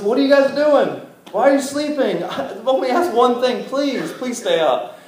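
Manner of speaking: acted-out incredulous complaint; exasperated pleading. Jesus pleads with sleeping friends.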